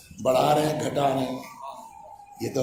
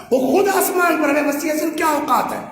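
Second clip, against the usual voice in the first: high, loud pulpit cry; shouted praise, much higher and louder; preaching shout; 12 semitones higher, 4 dB louder than usual. Real, not acted. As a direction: lift it to the rafters. Preacher exalting Ali from the pulpit.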